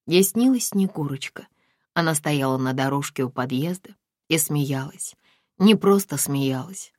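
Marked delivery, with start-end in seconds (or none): none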